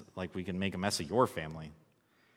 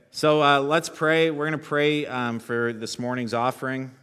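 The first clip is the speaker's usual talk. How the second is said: official; 5 semitones higher, 9 dB louder than usual. Real, not acted. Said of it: "I sound really official".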